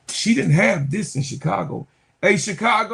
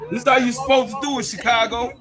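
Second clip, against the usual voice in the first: higher, louder and fast, crowing at Chicago; gleeful shout; 5 semitones higher, 4 dB louder, faster than usual. Real, not acted. Vlogger shouts at Chicago Sky over how to treat players, fast gleeful yell. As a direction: yell it out like a victory lap.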